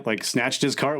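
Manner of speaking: ordinary talk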